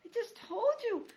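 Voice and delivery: high, begging mouse voice; pleading. Acted. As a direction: plead with the grumpy porcupine. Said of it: "Poppy pleaded".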